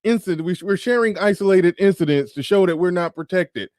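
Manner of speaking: high-pitched mock plea of victimhood; mocking complaint. Mocking women sharing viral videos.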